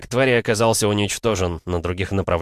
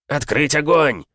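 loud, high officer's fire command; shouted order; 8 semitones higher, 4 dB louder, slower than usual. Acted. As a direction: yell the order to open fire. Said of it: "заорал капитан".

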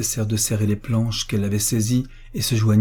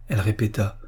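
plain reading voice

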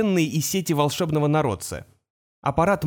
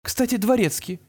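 mother's voice; 6 semitones higher than usual. A narrator's reading.

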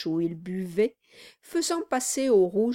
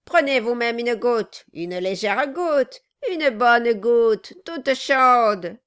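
louder, coaxing offer, slowing at the end; gleeful goading; 3 semitones higher, 7 dB louder than usual. A narrator's reading. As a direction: press the drink on him. Dwarf presses scalding drink on Brass.

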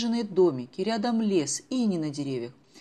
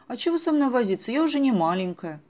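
protesting; 6 semitones higher, 7 dB louder than usual. Acted. Daughter protests being small.

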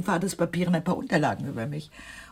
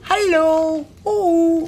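high, loud sing-song hallo; calling out; 11 semitones higher, 9 dB louder, slower than usual. Acted. Aunt waves to the young man across the casino, loud high call. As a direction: hail someone across a noisy casino.

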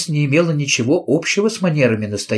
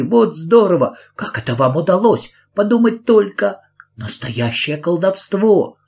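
excited; 6 semitones higher than usual. Acted. Carrie delighted by the magic.

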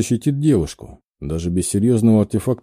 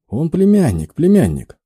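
higher, hurried insistence, lightly acted; rattled off; 6 semitones higher than usual. A narrator's reading. Woman covers for her companion's false name, rattling it off. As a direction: blurt it out and repeat it. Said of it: "протараторила девушка".

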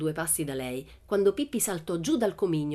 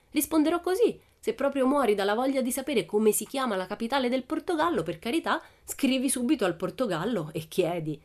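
put-on high, cheeky girl voice, much higher; cheeky Pippi; 7 semitones higher than usual. Pippi taunts the teacher, high cheeky girl. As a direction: tease the grown-up with a sing-song answer.

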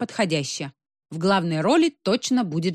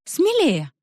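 encouraging; 10 semitones higher, 4 dB louder than usual. A narrator's reading. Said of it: "подбодрила Нинсанна".

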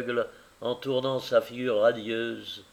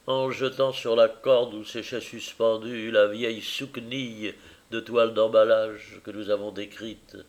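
ordinary delivery

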